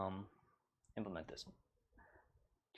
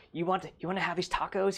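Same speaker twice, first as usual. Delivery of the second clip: lightly voiced creepy stranger, pitched higher; a hint of a creepy troll offering tacos; creepy guy; 6 semitones higher, faster than usual. Teacher mimics a troll tempting Molly, mildly creepy. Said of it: "He's all creepy".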